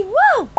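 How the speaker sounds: sudden high yelp; involuntary burst mid-sentence; vocal tic whoa. Real, not acted. Tic cutting off her sentence, high yelp. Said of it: "it's starting to happen again".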